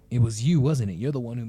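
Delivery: angry loud accusation; accusing. Acted. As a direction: pin the blame on him. Accusing the stranger.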